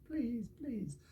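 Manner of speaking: high, soft little plea; begging. Shown as a demonstration. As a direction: demonstrate a storybook animal begging softly. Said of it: "you're changing your voice tone".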